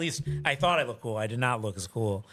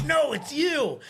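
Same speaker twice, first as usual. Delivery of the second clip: high mock yell; half-yelled, insistent accusation; retold screaming; 12 semitones higher, 4 dB louder, much slower than usual. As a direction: imitate a drunk man insisting. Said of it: "He starts screaming this at me".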